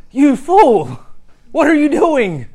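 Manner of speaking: high, loud mock-outraged yell; exasperated outburst. Preacher replaying his road rage at a cyclist, outraged yell. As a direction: yell it as an acted inner rant. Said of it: "I can feel myself even now just, like, thinking".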